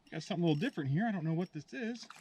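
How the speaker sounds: ordinary talk, nothing marked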